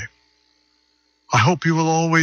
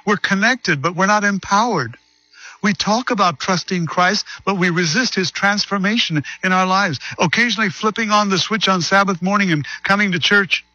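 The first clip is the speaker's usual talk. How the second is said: preaching; 7 semitones higher than usual. Acted. Preaching appeal.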